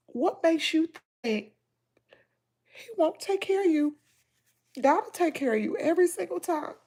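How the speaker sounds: high, soft, tearful voice; holding back sobs. Real, not acted. To her viewers, softly reassuring them through tears. Crying, speaking of God's care.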